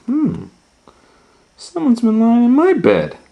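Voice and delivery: high, loud motherly bear voice; high-voiced bear remarking on an intruder; Mama Bear. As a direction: pitch it up high, a puzzled complaint. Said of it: "Mama Bear sat in her bed".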